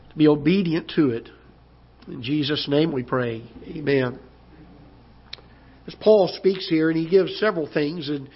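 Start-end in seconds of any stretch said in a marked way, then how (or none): none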